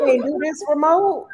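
high, put-upon whine; imitating herself, high and weary; exasperated quote. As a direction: moan it like you're fed up with remote work. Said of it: "I just be like".

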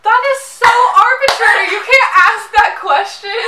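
loud, high laughing protest; indignant outburst. Real, not acted. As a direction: exclaim in mock outrage. Protesting a silly question.

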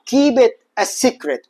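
very slow, every word drawn out; slow model phrase. Shown as a demonstration. Teacher introducing a new phrase, very slow. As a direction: spell the phrase out for the class. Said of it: "كل ما تريد أن تقول".

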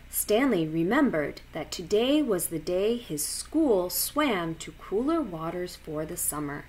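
usual speaking voice

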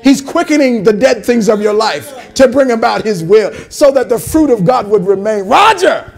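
loud, fervent calling out over each person; prophetic declaration. Real, not acted. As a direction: declare it over them with fire. Prophesying over congregation members.

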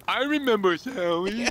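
slow, high silly horse voice; Sally voice. Retold or imitated. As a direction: mimic a dopey horse. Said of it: "That's how Sally, that's how Sally talked".

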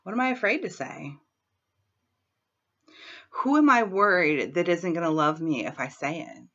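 ordinary talk

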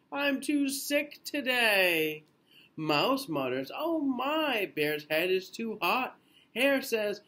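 lilting storybook read-aloud; gentle bedtime picture-book reading; reading to children. A narrator's reading. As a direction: read it slowly to little ones.

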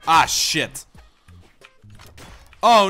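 normal talking voice

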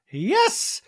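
high, loud imitation; hammed-up jumpy exclamation; mock excited yelp. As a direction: yelp it out suddenly. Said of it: "And Dorit's like".

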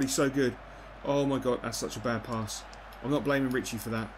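usual speaking voice

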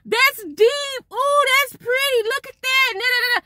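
high, mock-childish oohing at the view; kids oohing. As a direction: imitate the kids gasping in wonder, way up high. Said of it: "kids in the back talking about".

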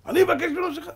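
high, loud incredulous question; indignant disbelief. Acted. Haman told to beg forgiveness, high indignant outburst. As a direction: throw it back outraged and high. Haman scorns advice to apologize.

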